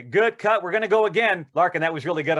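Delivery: calling cut. Retold or imitated. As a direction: shout across the set, pleased.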